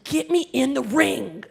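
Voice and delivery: slow, loud mock wrestler promo; little kid doing a wrestler voice; wrestler bravado. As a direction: growl it like a ring entrance. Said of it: "I was like".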